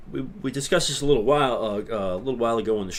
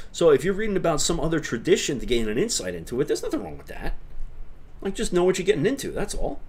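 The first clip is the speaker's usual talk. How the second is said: much higher, mock-opinionated character voice; put-on high voice; 7 semitones higher, faster than usual. Streamer answering a viewer on non-orthodox books, put-on high voice. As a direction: pitch it way up, like a character giving a verdict.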